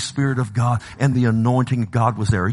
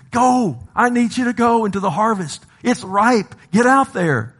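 high, ringing preacher's command; urgent command; 9 semitones higher than usual. Acted. Jesus sends his workers into the harvest, urgent and commanding. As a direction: send them out with raised, urgent force.

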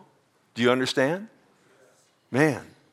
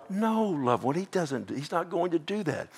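softer, higher, gently; tender reassurance; 6 semitones higher, 5 dB quieter than usual. Real, not acted. Preacher comforting a doubting listener, soft and tender. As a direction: reassure a worried believer, softly.

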